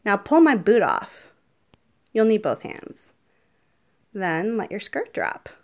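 normal talking voice

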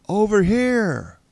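high, loud, drawn-out yell. A narrator's reading. Splicer luring the pursuer onward, yelling. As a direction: yell it long and high to be found. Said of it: "he yelled".

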